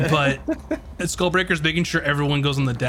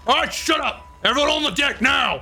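barked order; 10 semitones higher than usual. Acted. Ship's officer orders the crew on deck, yelling. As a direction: shout down a grumbling crowd. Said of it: "He's like".

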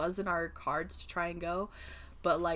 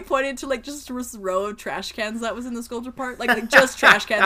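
ordinary delivery

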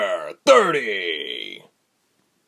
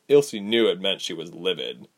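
ordinary delivery

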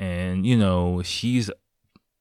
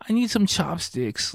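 put-on high-pitched needy man-child; mock complaining; 11 semitones higher than usual. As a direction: imitate childish begging in a high voice. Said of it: "complaining, asking for".

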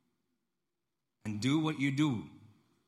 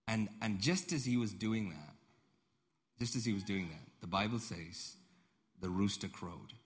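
usual speaking voice

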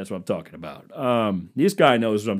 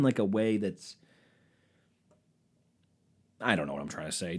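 normal talking voice

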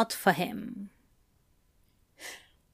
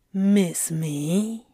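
seductive demon purr; 2 semitones lower than usual. A narrator's reading. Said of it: "he purred".

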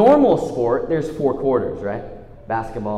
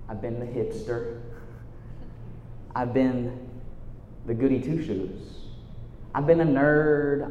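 softer, quicker, confiding preacher voice; intimate sermon; 6 semitones lower, 7 dB quieter than usual. Real, not acted. Pastor confiding in his youth group, soft and sincere. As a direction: let the congregation in, hushed and close.

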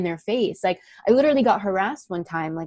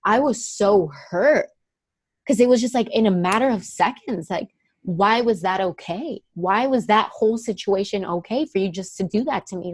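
ordinary talk, nothing marked

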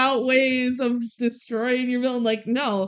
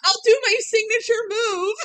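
high, louder mock boast; Athena's boast; 12 semitones higher, 4 dB louder than usual. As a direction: show off like a cartoon hero. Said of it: "Athena says".

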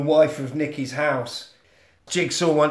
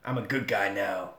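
lower, softer put-on voice; low earnest denial; 6 semitones lower, 4 dB quieter than usual. Reformed cop answering the accusation, low and quiet. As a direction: drop low and deny it quietly. Acting Colin denying his past.

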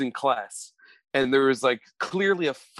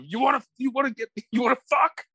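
mock angry challenge; 10 semitones higher, faster than usual. Acting an angry person.

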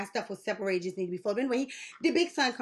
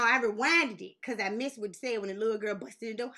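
usual speaking voice

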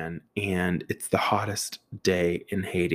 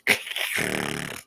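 long rasping scrape noise; metal scraping imitation; 5 semitones lower, 5 dB quieter than usual. Storyteller mimics stop sign on van roof, scraping sound. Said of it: "all you hear is this metal stop sign on the roof".

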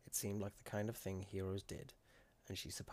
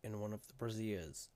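usual speaking voice

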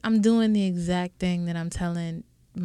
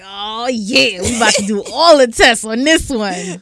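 excited doctor; 3 semitones higher, 9 dB louder than usual. Retold or imitated. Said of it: "You can see 'em like".

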